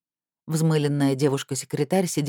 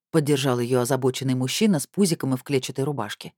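ordinary delivery

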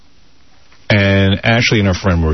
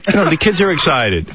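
ordinary talk

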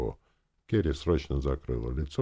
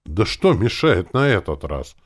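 loud, raised, slow-paced challenge; exclaiming; 6 semitones higher, 10 dB louder than usual. A narrator's reading. Uncle challenging the refusal to rob, exclaiming. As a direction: demand it with exasperated force. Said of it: "воскликнул дядя Фома".

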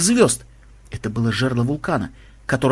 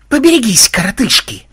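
very loud, high warning yell; 9 semitones higher, 7 dB louder than usual. A narrator's reading. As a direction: bellow the warning over battle. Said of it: "завопил Ксенобайт".

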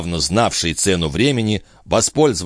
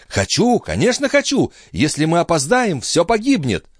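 high-pitched urgent woman's voice; anxious pleading; 11 semitones higher than usual. A narrator's reading. Chon fears they will be late, anxious pleading. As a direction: plead high, as if all is at stake.

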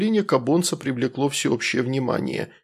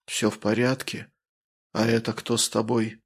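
soft, even, rustling tone; calm rustling voice; 3 dB quieter than usual. Acted. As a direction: keep it calm and whispery. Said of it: "голос остался спокойно шелестящим".

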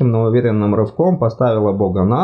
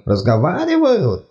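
slow, higher disbelieving echo; incredulous; 8 semitones higher than usual. Acted. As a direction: repeat it in disbelief.

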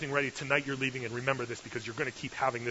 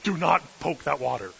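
loud, raised-pitch scolding; acted-out exasperated parent laying down the law; stern parental warning; 5 semitones higher, 11 dB louder than usual. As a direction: warn a little kid off sharply. Parent warning little kid, acted out.

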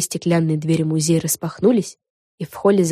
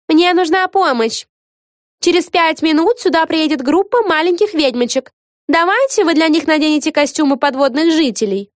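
put-on high, loud storybook girl voice; high-pitched museum guide asking for help; bored teenage guide character; 11 semitones higher, 5 dB louder than usual. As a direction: give instructions in a high character voice.

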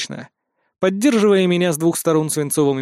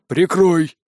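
short urgent shout to cover him; 3 dB louder than usual. A narrator's reading. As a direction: call out the order sharply. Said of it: "но тот крикнул". (короткий крик «Прикрой!»)

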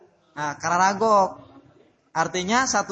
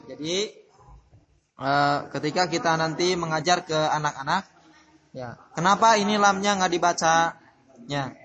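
ordinary talk, nothing marked

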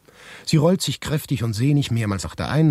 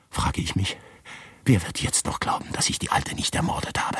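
whisper; 5 dB quieter than usual. Acted. Fearful thoughts about being accused.

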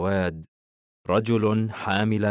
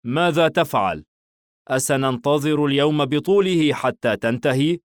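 higher, louder, impatient complaint; displeased; 6 semitones higher, 5 dB louder than usual. A narrator's reading. Madi scolds his dawdling friend Hayeem, displeased. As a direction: complain with raised pitch, clearly annoyed. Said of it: "ماضي باستياء".

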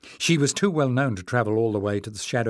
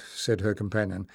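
ordinary delivery, nothing marked

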